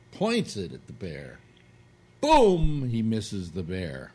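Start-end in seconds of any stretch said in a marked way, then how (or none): 2.2-2.9 loud high vocal gunshot; gunshot boom. Acted. Teller imitates the hunter's gun, loud vocal boom. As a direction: shout the shot like a cartoon blast.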